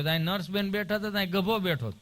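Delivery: ordinary delivery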